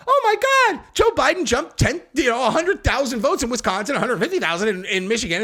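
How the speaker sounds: half-hearted fake shock, fading; mock alarm. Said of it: "it's all".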